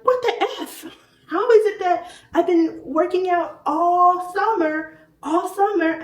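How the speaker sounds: loud, high-pitched mock outrage; indignant disbelief. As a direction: exclaim it high and loud, dragging out the complaint. Retelling her reaction to the scan.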